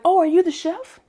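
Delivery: high, loud, eager question; eager greeter. Retold or imitated. Event helper greeting a newly arrived chef, eager and loud.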